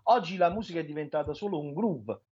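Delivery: usual speaking voice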